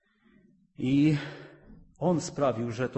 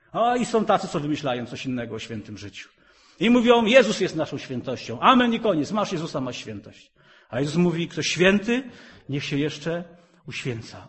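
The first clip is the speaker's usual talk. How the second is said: loud, high, fast fiery preaching; preaching at full force; 8 semitones higher, 10 dB louder than usual. Acted. Preacher rousing the church to holy living, fiery and loud. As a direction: thunder it at the congregation.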